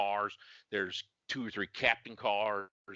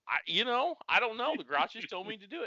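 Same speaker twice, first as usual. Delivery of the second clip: higher, flustered stammer, then fast; mock sheepish self; 13 semitones higher, 3 dB louder than usual. Officer answering the angry sergeant, flustered and fast. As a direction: fumble an excuse.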